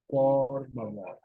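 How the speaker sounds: "por favor" said slowly, each syllable clear; slow teacher model of "por favor"; slow demo pronunciation. Shown as a demonstration. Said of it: "pronounce them a little bit slowly".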